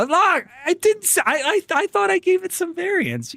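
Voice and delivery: very high, protesting falsetto; mock-wounded squeal. Streamer defends the impression to teasing chat, mock-wounded squeal.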